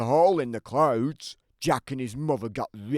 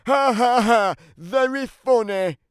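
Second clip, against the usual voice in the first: higher mock laugh, dripping sarcasm; sarcastic fake laugh; 9 semitones higher, 6 dB louder than usual. Giant mocking the kids who ring his bell, sarcastic. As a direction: laugh it off sourly, then scoff.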